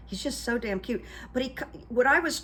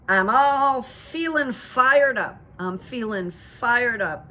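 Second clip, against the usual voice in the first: louder, higher, slow rally chant; fired-up impression; 4 semitones higher, 6 dB louder, slower than usual. Obama leaning in to the crowd, slow hammed-up rally call. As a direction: drawl it out like a rally leader. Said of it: "he leans over and he goes".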